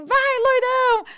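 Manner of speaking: loud, high whooping shout; retold crowd yell. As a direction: holler it like someone in the back of the hall. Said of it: "uma pessoa falando assim". (berro animado de plateia, bem alto)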